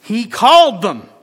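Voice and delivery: preacher stressing that Jesus called them; emphatic. Real, not acted. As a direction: land it hard.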